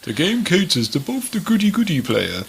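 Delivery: put-on old grandpa storyteller voice; granddad voice. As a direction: read it like a kindly granddad. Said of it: "That's my granddad voice".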